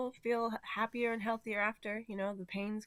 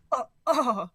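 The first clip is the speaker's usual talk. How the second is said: pained groan; 3 semitones higher, 9 dB louder than usual. Retold or imitated. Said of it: "she was like".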